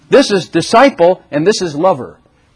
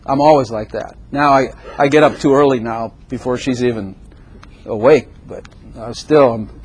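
ordinary delivery

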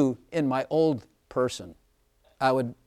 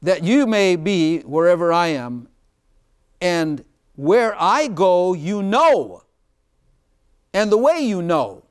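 louder, solemn reading of the verse; scripture reading; 3 semitones higher, 7 dB louder, slower than usual. Acted. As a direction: read the verse out with weight. Reads scripture aloud.

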